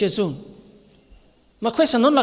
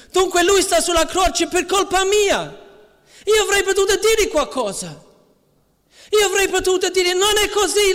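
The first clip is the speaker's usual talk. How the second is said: much higher, anguished inner voice of a guilty Nicodemus; Nicodemo tormentato; 12 semitones higher than usual. Acted. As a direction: cry out his remorse, pitched high. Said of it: "pensando fra di sé". (voce alta e angosciata di Nicodemo pentito)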